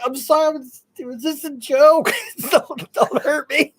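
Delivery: high, pleading mock fright with a laugh; mock scared pleading. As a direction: play the scared victim begging, half-laughing. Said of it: "It's like".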